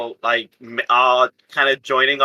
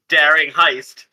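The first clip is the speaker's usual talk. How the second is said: ordinary talk, nothing marked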